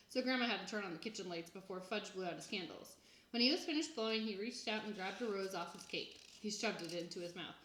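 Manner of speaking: ordinary delivery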